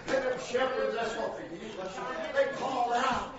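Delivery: ordinary talk, nothing marked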